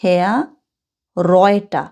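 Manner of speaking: হেয়া রয়টা said slowly and clearly, showing the German vowel; teacher sounds out হেয়া রয়টা for the class; carefully modelled pronunciation of হেয়া রয়টা; German pronunciation demo. Shown as a demonstration. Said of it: "আমি উচ্চারণটা করে দিচ্ছি".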